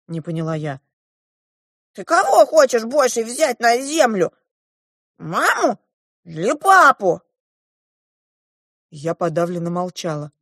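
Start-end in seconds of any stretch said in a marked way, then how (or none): 1.9-7.2 high, loud dwarf questioning; pressing dwarf. A narrator's reading. The dwarf makes the girl choose a parent, pressing.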